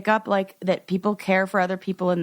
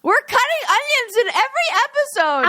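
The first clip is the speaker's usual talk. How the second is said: teary excitement; 3 dB louder than usual. Real, not acted. Both tearful after the shriek.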